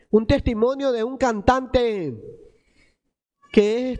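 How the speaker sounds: ordinary delivery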